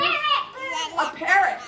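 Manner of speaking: drawn out slowly and high; a slow, high, delighted answer; excited reveal. Real, not acted. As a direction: stretch it out high and happy. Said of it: "Any other bird?".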